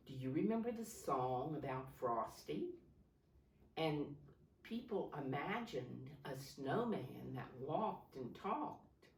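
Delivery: ordinary talk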